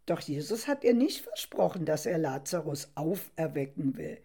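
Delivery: ordinary delivery, nothing marked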